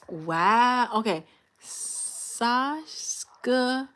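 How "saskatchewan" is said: "Saskatchewan" very slowly, piece by piece; sounding it out. Real, not acted. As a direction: feel out each syllable of "Saskatchewan". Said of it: "How I pronounce that?".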